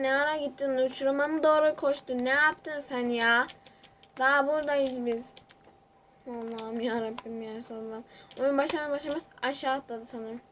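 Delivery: ordinary talk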